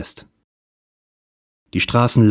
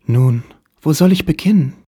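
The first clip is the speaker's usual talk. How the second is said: much higher, louder put-on woman's voice; wealthy client starting her tale; femme fatale voice; 9 semitones higher, 5 dB louder than usual. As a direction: play the helpless rich wife.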